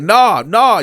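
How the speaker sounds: high, loud cutting in; loud interruption. Retold or imitated. Cellmate cutting Dante off, loud and high. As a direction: talk over him. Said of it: "He said".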